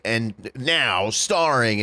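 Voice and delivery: slow, drawn-out trailer announcement; mock trailer announcer. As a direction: stretch it out like a trailer title. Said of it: "at the end it was like".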